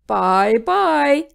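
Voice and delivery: cheerful creature farewell; happy monster goodbye; fluffy monster. A narrator's reading. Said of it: "said the fluffy monster".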